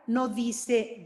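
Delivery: usual speaking voice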